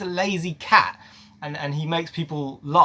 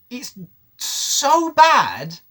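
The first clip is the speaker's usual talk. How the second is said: 1 drawn-out exasperation; 7 semitones higher, 4 dB louder, much slower than usual. Real, not acted. Exasperated verdict.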